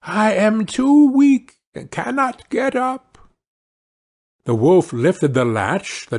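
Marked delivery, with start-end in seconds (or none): 0.0-3.2 very high, slow granny cry; high-pitched weak old woman; frail grandmother. Acted. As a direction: call out helplessly from the sickbed. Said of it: "cried out the grandmother".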